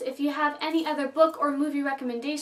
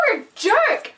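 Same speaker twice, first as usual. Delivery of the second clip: louder, higher jab at the character; outraged outburst; 6 semitones higher, 6 dB louder than usual. Real, not acted. Venting at the boyfriend character, loud and outraged. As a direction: spit it out at him like he's there.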